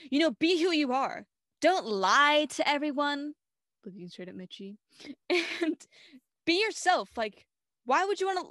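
loud, preachy speech voice; preachy Shane. Retold or imitated. Shane's monologue aimed at Mitchie, mock preachy. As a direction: lecture everyone pointedly. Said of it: "whole monologue being like".

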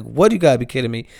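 ordinary talk

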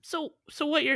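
a host hammed-up as overcome with feeling; mock emotional. Podcast host pretending to choke up at his guest's confession, mock emotional. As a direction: voice jumping high with pretend emotion. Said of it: "I'm getting emotional again".

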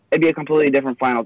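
nervous rush of words; talking really fast. Real, not acted. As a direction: rattle it off quickly. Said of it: "I'm talking really fast".

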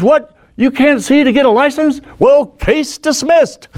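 high-pitched mock judge's outburst; high, indignant judge ruling on the spot; incredulous judge. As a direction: act out a baffled judge, voice way up. Retold judge reacts to blind driver.

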